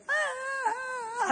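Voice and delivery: a high, breaking cry that bursts out; sudden crying wail. Retold or imitated. Telling the congregation how she broke down, sudden high wailing cry. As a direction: let a sob burst out mid-story.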